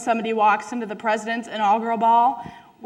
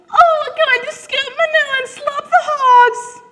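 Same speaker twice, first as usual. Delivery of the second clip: hammed-up French accent, much higher; fake French accent; 7 dB louder than usual. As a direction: say farm chores in a posh mock French accent. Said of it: "in a French accent?".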